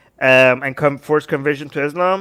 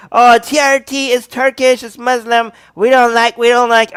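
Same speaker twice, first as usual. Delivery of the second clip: high, louder mock protest; mocking complainers; 7 semitones higher, 5 dB louder than usual. Host parodies viewers objecting to Turkish sources, mocking. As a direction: imitate whiny objectors, higher and louder.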